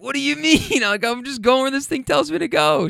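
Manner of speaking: high-pitched laughing protest; mock exasperation. Driver defending the route, high mock exasperation. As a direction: act out pleading innocence, way up high.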